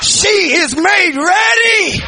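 loud, high preaching with a shouted build; full-force preaching. Real, not acted. Preacher urging the church not to back down, shouting. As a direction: drive the congregation, don't let them back down.

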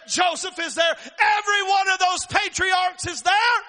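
rising sermon fervor ending in a shout; preaching at full force. Real, not acted. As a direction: preach it, climbing to the rafters.

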